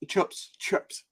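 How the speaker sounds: "chips" said as "chups"; U instead of I. Shown as a demonstration. Mocking how they order fish and chips, "chips" as "chups". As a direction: say "chips" with a short U for the I. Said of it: "it's U instead of I".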